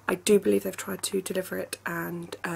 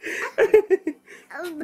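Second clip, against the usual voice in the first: high, loud burst of giggles; playful laughter; 8 semitones higher, 5 dB louder than usual. Real, not acted. Tickle game with the child, high playful laughter. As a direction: laugh helplessly in play.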